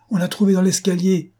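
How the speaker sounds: high, urgent bad news; alarmed report. A narrator's reading. Staff telling the chief of the attack, alarmed and high=-. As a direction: report it breathlessly urgent, pitch way up.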